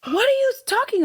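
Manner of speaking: high-pitched, baffled retort; mock disbelief. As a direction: act out being baffled by the request. Acts out disbelief at Cockney request.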